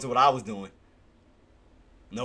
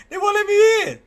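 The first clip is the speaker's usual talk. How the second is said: high-pitched, loud complaint; frustrated wail; 7 dB louder than usual. Real, not acted. Trader still shut out as price climbs, high wail.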